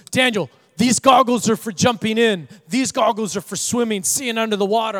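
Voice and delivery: bursting to show off his goggles; excited nephew. Retold or imitated. Nephew excitedly explains his goggles to uncle Daniel, eager and loud. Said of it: "Jude was so excited".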